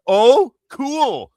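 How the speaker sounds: high, loud, mocking "Oh, cool."; sarcastic. Real, not acted. Ranting at Americans who claim no need for Canada, loud sarcasm. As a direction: toss it back with scornful sarcasm.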